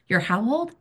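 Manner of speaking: 1 mock astonished. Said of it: "they go".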